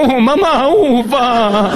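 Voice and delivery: put-on high whiny child voice; whining kid. Kid begs mom at the door, high whine. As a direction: whine like a caught little boy. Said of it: "te descuajeringabas diciendo".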